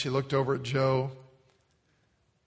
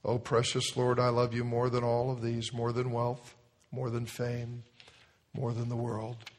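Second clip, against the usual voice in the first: devout prayer; 4 dB quieter than usual. Acted. Answering prayer.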